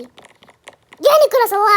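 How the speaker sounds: drawn-out loud call of the name; frustrated yell. Real, not acted. Gamer yelling at Nicolas on screen, drawn-out frustrated call.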